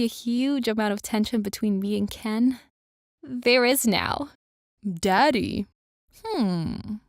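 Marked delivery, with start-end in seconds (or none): 4.8-7.0 cheeky pondering. A narrator's reading. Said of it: "Oliver ponders with a cheeky grin".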